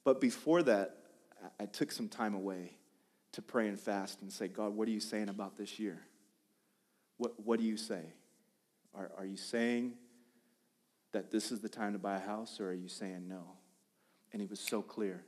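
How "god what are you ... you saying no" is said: soft, low, halting question to God; hushed, searching prayer; quiet prayer. Retold or imitated. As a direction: ask softly and earnestly, pausing between phrases. Retold private prayer seeking guidance.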